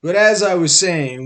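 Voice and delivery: a jackal taking back the floor; stern jackal voice. Acted. Jackal resumes.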